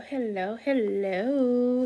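big loud showy hello; loud sing-song call. Acted. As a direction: belt the greeting out like calling across a room. Escalating hello greeting.

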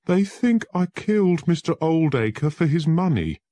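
high, loud, distressed; poor accused lawyer pleading; anguished fear. Acted. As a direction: cry out in despair. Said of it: "said the poor man".

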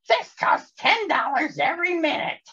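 put-on a little girl's high protest; high-pitched sister yelling. A narrator's reading. Sister crying out about the cost of the call, high and alarmed. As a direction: squeak out the panic about the bill. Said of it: "sister yelled".

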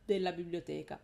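ordinary talk

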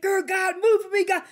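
imitating a fervent prayer, pitched high; pleading prayer. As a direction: call out to God eagerly. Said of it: "Like, like".

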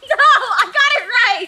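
high, slow loud insistence; loud protest. Real, not acted. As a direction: insist loudly. Loud playful argument.

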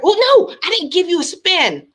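high, loud burst of dismay; dismayed realization. Real, not acted. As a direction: cry out on suddenly catching your own slip. Realizes she forgot a spin.

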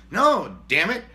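usual speaking voice

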